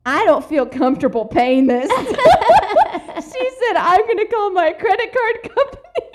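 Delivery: high, loud laughing mock indignation; laughing through the quote. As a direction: lose it while quoting Mom. Quoting Mom, dissolving into laughter.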